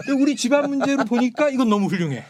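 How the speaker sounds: high, loud mock admiration; admiring family man. Son-in-law proud of his family's doings, high mock-admiring voice.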